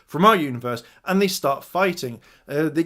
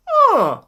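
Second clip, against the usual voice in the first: high-pitched, loud questioning huh; acted high-pitched "huh?"; puzzled huh; 7 dB louder than usual. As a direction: let out a high, surprised huh. Acts out puzzled reaction.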